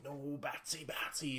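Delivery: mock taunting cartoon villain, kept soft; Joker. Friend mimics a laughing Joker taunting Batman, lightly teasing. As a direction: lightly imitate a playful villain's drawn-out refusal. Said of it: "like a Joker going laughing and saying".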